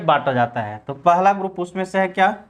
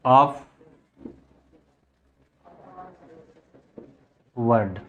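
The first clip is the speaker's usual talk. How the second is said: low, very slow, long pauses between words; writing-speed delivery, word by isolated word; slow dictation; 4 semitones lower, much slower than usual. Real, not acted. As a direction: dictate it piece by piece while writing.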